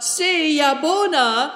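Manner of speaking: high, long-drawn farewell call. A narrator's reading. Girl calling after departing riders, calling out. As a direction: call it out slowly, pitched high. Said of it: "and she called out".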